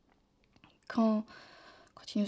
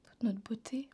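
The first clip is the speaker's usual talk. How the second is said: softly, with long pauses between items; quiet, halting lament; hushed, wistful; 8 dB quieter than usual. Acted. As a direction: let each one fade almost to a whisper. Wistful listing of what time takes.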